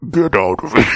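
loud mock Vader bark, cracking up; Darth Vader. Hosts riffing, then a loud laughing mock Vader yell. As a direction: shout it like a joking Vader. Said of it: "Don't ever do Darth Vader".

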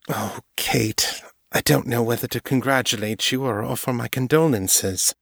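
commiserate with her friend gently; understated Morgan, torn; a touch of sympathetic Morgan. A narrator's reading.

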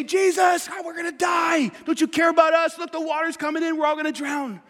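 high, frantic cry for help; shouting in fear of drowning; panicked disciples. Retold or imitated. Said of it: "and they say".

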